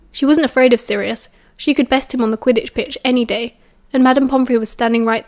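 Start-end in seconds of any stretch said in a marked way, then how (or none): none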